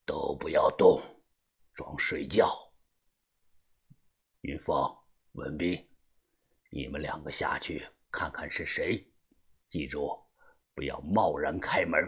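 low, quiet, measured orders; deep hushed command. A narrator's reading. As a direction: keep the voice down and grave. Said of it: "把头立即沉声地说道". (沉声低语地吩咐)

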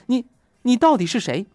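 stammered, high, loud shock; startled question. Retold or imitated. Groom to unknown bride under the veil, aghast. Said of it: "不由得大惊失色，连忙问".

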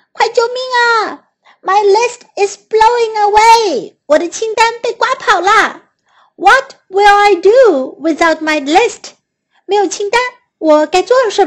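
high, loud panicked crying out. A narrator's reading. Toad cries to Frog that his list is gone, high loud panic. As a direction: wail it out as the list flies away. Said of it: "癞蛤蟆叫道".

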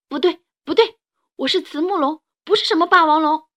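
put-on high, loud outraged child; angry child. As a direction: stamp it out in fury. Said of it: "梁太发怒了".

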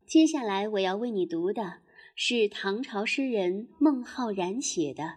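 ordinary delivery, nothing marked

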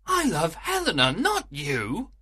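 high, scornful declaration; mocking. A narrator's reading. Lysander tells Hermia he prefers Helena, mocking. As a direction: taunt and reject her. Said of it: "Lysander mocked her".

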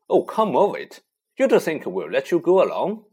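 loud scoffing disbelief. Acted. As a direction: brush off the idea loudly. Ron scoffing.

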